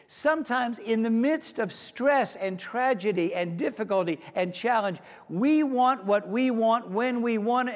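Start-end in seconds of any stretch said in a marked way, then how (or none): none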